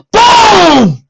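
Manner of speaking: high, loud boom; dramatic boom. A narrator's reading. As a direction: hit the crash of the avalanche. Avalanche sound narrated.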